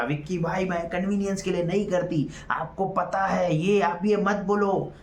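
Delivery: a retold screaming match, voiced high; mimicking a shout. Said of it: "चिल्ला चिल्ला के बोला".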